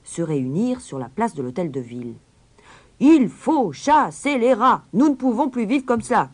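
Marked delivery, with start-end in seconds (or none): 3.0-6.3 loud, high, angry crowd cry; crowd shouting. Acted. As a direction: shout it out like a furious mob. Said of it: "criaient-ils". (foule en colère qui crie fort)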